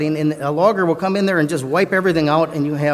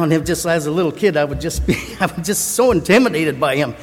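usual speaking voice

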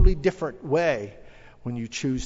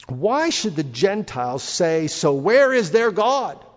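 slow, loud, raised-pitch challenge; declaimed scripture; 3 semitones higher, 6 dB louder, slower than usual. Acted. Preacher reading the Gentiles' taunt to the congregation, declaimed. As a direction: ring the question out slowly and forcefully.

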